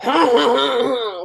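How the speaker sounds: long, drawn-out wailing ha-ha; imitated pained cry. Friend mimics people hit by a scooter, drawn-out wail. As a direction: imitate someone hit, stretching each sound. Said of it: "they make a sound that says".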